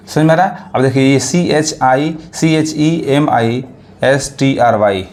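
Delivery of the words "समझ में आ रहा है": usual speaking voice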